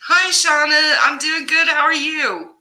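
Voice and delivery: high bright hello; cheery greeting. Real, not acted.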